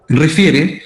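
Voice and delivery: ordinary talk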